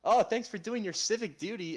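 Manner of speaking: high, loud, sugary canvasser imitation; exaggerated high-pitched thanks; mock gratitude. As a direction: thank them in an over-chirpy put-on voice. Mimicking chirpy canvassers.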